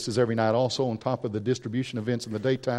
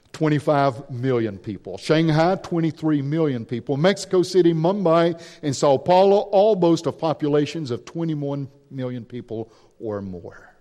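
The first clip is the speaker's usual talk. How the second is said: usual speaking voice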